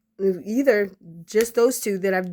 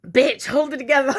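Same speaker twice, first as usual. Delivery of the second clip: louder, higher self-scolding; holding back tears; 5 semitones higher, 5 dB louder, faster than usual. Real, not acted. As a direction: pull yourself together before you cry. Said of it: "I'm about to cry".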